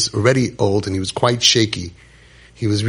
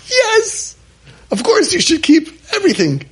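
much higher, put-on sing-song voice; mock preachy answer. Rabbi mocking the answer he refused to give, mock high voice. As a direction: parody the pushy rabbi he refused to be.

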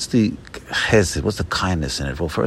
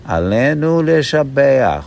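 slow recitation; 6 semitones higher, 4 dB louder, slower than usual. Shown as a demonstration. Teacher reciting a prayer for listeners, Alenu Leshabeach said slowly. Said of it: "Let's say it slow".

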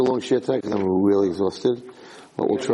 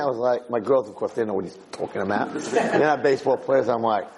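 usual speaking voice

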